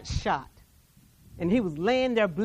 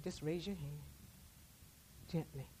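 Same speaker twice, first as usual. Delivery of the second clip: much softer, lower and tender; gentle voice of God; 5 semitones lower, 20 dB quieter than usual. Acted. God telling the survivor to raise her hand, tender and soft. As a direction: coax her like a whisper.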